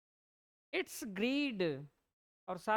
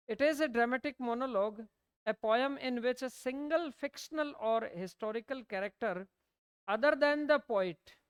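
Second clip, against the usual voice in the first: slow, even note-dictation pace; 3 semitones higher, 3 dB louder, much slower than usual. Acted. Teacher dictating notes to students, slow and deliberate. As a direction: read it out word by word so students can write.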